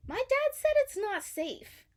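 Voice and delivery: high small-fish voice, hesitant; timid Nemo. A narrator's reading. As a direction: say it like a worried little kid. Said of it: "Nemo said".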